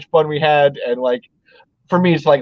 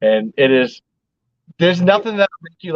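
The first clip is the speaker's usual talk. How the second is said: ordinary talk, nothing marked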